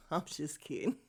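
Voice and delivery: a soft, half-swallowed laugh through the words; giggled quietly under the breath. Real, not acted. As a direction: take back the jab while still giggling. Laughs after a joke jab.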